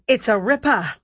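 imitating an Aussie host's catchphrase; amateur attempt at an Australian accent; bad Australian accent. Imitating the TV host who loved the renovation, bad Australian accent. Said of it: "My Australian accent is absolutely abysmal".

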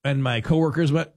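ordinary talk

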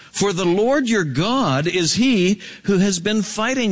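high, fervent proclamation; stirring high-pitched preacher's reading; impassioned scripture preaching. Acted. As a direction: proclaim it to the people with pitch lifted.

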